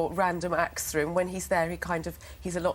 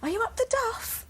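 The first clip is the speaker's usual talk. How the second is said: high cheeky voice. Retold or imitated. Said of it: "So I went".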